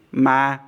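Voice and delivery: "ma" held on a level pitch; flat tone. Shown as a demonstration. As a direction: say "ma" flat as a board, without bending. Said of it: "As a flat board tone".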